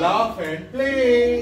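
very slow, drawn-out reading; slow teacher demonstration; slow model reading. Shown as a demonstration. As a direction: stretch each word for the class to copy. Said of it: "teacher models the line".

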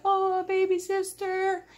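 high, slow, sugary brother voice; fake-nice big brother. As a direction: croon it like a scheming sweet brother.

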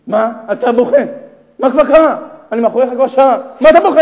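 high, forceful acted questioning; loud demanding stranger. Stranger behind a man crying at the Kotel, loudly demanding.

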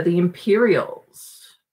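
usual speaking voice

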